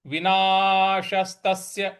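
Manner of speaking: विनाशस्तस्य said slowly with the half स clear; slow careful. Shown as a demonstration. Teacher shows how to say the word. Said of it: "इस प्रकार से बोलेंगे".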